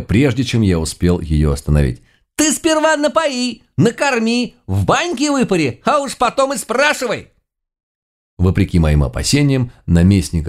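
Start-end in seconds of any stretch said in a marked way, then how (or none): 2.4-7.3 put-on very high-pitched scolding old woman; snapping granny. A narrator's reading. Baba Yaga scolding an inhospitable governor, indignant. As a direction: scold shrilly, pitched far up, without shouting. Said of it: "рявкнула моя домохозяйка".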